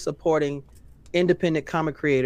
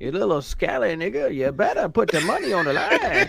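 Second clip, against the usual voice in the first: lightly voiced scolding old man; a hint of a cranky grandpa; grumpy old man; 4 semitones higher than usual. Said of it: "an old man voice who's not".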